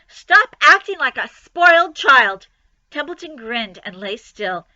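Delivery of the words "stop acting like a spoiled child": high, loud scolding; angry yell. Acted. Wilbur yelling at lazy Templeton, high and loud. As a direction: scold the rat furiously.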